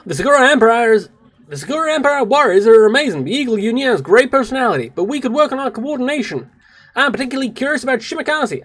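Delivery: high, loud, admiring heroine voice; enthusiastic game character. Acted. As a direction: gush eagerly about the military exercise.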